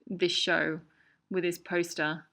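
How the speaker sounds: usual speaking voice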